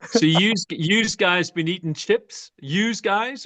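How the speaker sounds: put-on a shaky Northern Irish accent. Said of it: "I suck at accents".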